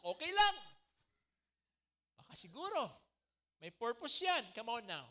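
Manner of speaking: loud, high, fired-up preaching; preaching at full force. Real, not acted. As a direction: rouse the congregation with passion. (masiglang pangangaral)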